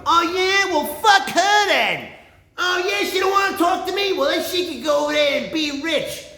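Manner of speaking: high, loud, whiny mimic of the guy; mocking high guy voice. Mocking the guy who got turned down, high and loud. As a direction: squeal it like an indignant jerk. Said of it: "I go".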